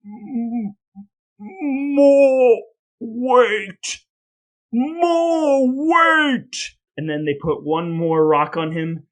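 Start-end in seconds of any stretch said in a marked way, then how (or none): 0.0-6.6 high, loud, strained gasps under the weight; strained martyr's cry. Retold or imitated. Giles Corey pressed under rocks, pained high defiant cry. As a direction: squeeze out each word like rocks crush his chest. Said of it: "But Giles Corey says".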